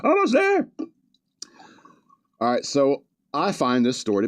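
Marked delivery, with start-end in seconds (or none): 0.0-0.7 very high, strained mock call; comic high-pitched impression; imitating co-host calling. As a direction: squeal it like someone calling from afar. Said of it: "He's like".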